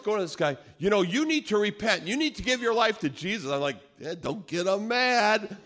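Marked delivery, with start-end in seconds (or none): 0.8-3.5 high, insistent street preaching. Retold or imitated. Miguel going at this guy, preaching insistently. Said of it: "preaching the gospel". 4.0-5.6 high, drawn-out anxious plea; nervous plea. Retold or imitated. Friend begging Miguel to stop, nervous.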